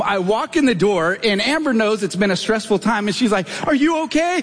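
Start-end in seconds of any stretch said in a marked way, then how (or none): 3.6-4.4 high-pitched imitation of a worried wife; concerned wife. Wife greeting her bawling husband at the door, high concerned voice. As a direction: ask in a raised, wifely voice.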